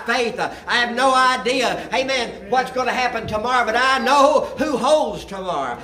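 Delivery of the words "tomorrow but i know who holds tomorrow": high, loud preacher testimony; fervent preaching. Acted. Pastor telling the church how faith bought the property, fervent. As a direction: testify with rising fire.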